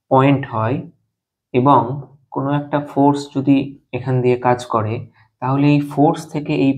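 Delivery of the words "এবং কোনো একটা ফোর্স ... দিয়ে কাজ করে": ordinary delivery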